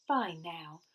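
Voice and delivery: ordinary delivery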